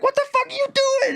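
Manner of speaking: very high shrieky imitation of a wife; mock outrage. Wife catching her man in the basement, mock high outrage. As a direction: demand it way up high, hammed-up.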